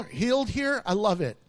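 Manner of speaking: normal talking voice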